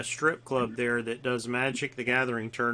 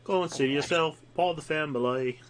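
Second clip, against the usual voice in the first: higher-pitched, exaggerated British impression; mock British accent; 3 semitones higher than usual. Joking the posh friend would never talk so, mock British accent. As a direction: ham up a streetwise British accent. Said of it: "He's not gonna come out".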